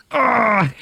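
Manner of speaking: high, hammed-up "ah" after a drink; imitated "ah". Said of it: "looked at the camera and he's like".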